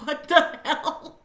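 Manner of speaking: slow, laughing what the hell; laughing disbelief. Real, not acted. Reviewer laughing at the absurd movie, slow stunned laugh. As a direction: drag it out through a laugh. I'm like.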